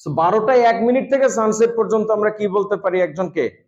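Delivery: ordinary talk, nothing marked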